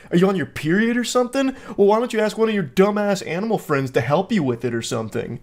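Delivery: hammed-up sneering dudebro; mock condescending guy. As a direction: talk down to her like a smug jerk. Mocking the guy's rude texts.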